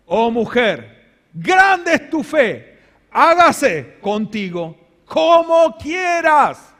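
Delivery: stately, deliberate blessing; solemn voice of Jesus. Acted. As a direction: bless her faith with weight.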